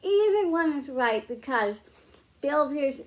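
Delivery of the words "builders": ordinary delivery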